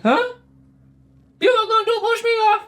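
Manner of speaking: high, startled disbelief; comic high-pitched panic; shocked squeak. Retold or imitated. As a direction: squeak it out like he just got betrayed. Guy realizes he'll be pushed.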